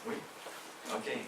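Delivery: ordinary talk, nothing marked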